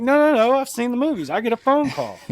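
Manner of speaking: half-mocking his younger self's indignant insisting; insistent retold protest. Drunk twenty-year-old arguing with a cop, mock-indignant protest.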